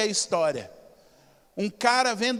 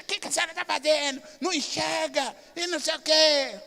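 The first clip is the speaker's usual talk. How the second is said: pretend rude driver; 6 semitones higher than usual. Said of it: "uma visão diferente".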